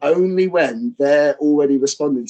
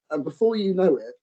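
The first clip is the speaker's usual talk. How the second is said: ordinary talk, nothing marked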